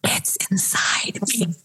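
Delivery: hushed, voiceless whisper; confiding whisper. Acted. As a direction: whisper it like a scared confession. Panicked traveler confides to officer.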